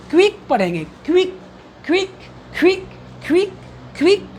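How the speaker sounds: क्विक as one short "kvik"; model pronunciation. Shown as a demonstration. Teacher shows students how to read it, model pronunciation. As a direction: repeat क्विक crisply as "kvik", pausing between takes. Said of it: "इस तरीके से पढ़ने का प्रयास करेंगे".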